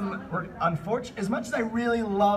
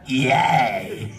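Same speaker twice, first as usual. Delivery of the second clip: hammed-up yay; 5 semitones lower, 5 dB louder than usual. Reading Eevee's cheer.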